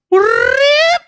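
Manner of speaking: high squeaky ripping noise; mock rip sound. Imitating the big knife cutting, a high squeal. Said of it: "and I go".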